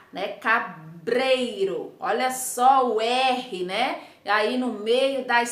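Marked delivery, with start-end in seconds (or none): 0.3-1.9 CABREIRO stretched out slowly as "ca-brei-ro"; very slow model of CABREIRO with tapped R; slow syllable demo. Shown as a demonstration. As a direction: sound out CABREIRO, dwelling on the BR syllable. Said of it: "olha o som que você forma".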